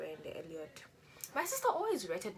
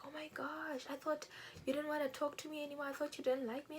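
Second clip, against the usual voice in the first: put-on excited boyfriend; 8 semitones higher, 3 dB quieter than usual. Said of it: "He was like".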